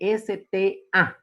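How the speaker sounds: letters said one by one, slowly; spelled out. Shown as a demonstration. Teacher spells "E S T A" for students, slowly. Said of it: "como es la palabra E".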